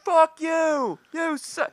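very high, loud mock jeer; crowd heckling. Party guests heckling the comedian, high mock yelling. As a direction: imitate an angry heckler yelling at the stage.

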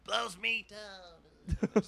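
very slow, deflated mutter; feeble drawn-out line. Retold or imitated. Defeated comic mumbles last Popeye line, slow. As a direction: trail off in defeat.

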